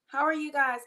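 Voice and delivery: ordinary delivery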